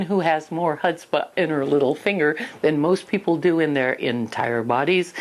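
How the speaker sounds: ordinary delivery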